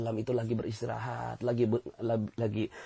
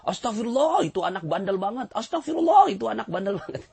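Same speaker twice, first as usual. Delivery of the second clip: mock scolding, shrill and louder; exasperated astagfirullah; 9 semitones higher, 5 dB louder than usual. Preacher mocks a parent blaming a naughty child, exasperated. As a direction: exclaim it while blaming the naughty kid. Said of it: "tapi ngomongnya gini".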